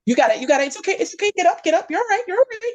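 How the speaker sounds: the universe helping the person up after the crash, soothing; comforting Source. Retold or imitated. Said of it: "Source comforting after the crash".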